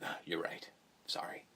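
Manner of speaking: soft, whispered sheepish apology; hushed meek backing down. Retold or imitated. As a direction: whisper it like a scolded villain. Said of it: "Freddy will just be".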